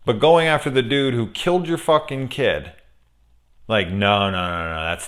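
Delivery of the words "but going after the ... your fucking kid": usual speaking voice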